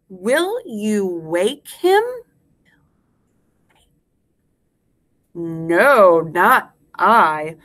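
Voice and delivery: slow, word by word; spaced-out nursery rhyme for repeating; slow rhyme recitation. Shown as a demonstration. Teacher leads class in the rhyme, slowly word by word.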